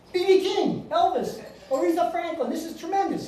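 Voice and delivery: high-pitched, thrilled exclamations; ecstatic. Retold or imitated. Son thrilled to be stuck in Memphis, ecstatic. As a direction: gush over each name in a high voice. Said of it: "Brian's ecstatic".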